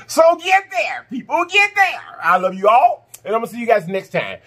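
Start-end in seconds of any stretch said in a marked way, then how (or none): 0.1-2.0 high, slow, punchy urging; pep-talk shout to her viewers; rallying cry. Real, not acted. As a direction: cheer them on to the finish.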